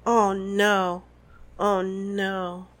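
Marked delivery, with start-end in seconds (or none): none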